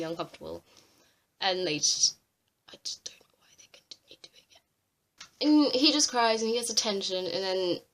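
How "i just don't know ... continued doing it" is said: breathy whispered aside, nearly silent; exasperated whispered aside. Real, not acted. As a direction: drop to a whisper as if confiding.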